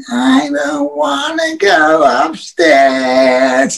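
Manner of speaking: high, long-drawn wail of refusal; hammed-up tearful drunk, half speed; mock drunken crying. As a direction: imitate a wasted man whining near tears. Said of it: "He was almost crying, but he was wasted".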